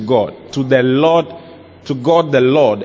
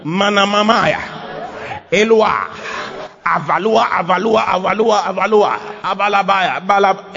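rhythmic repeated chant, slightly raised; mock praying in tongues; 3 semitones higher than usual. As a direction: imitate someone rattling off tongues. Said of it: "Not when you come now".

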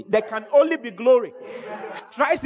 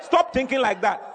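ordinary talk, nothing marked

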